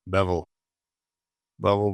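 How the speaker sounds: ordinary talk, nothing marked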